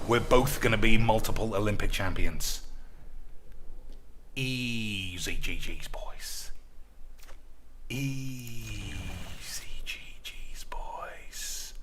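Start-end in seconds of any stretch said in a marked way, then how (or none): 4.4-6.5 low, slow, quieter catchphrase; fading victory sign-off; softer sign-off. Pretend. 7.9-11.7 slow breathy whispered catchphrase; secretive whispered sign-off. Pretend. Whispered repeat.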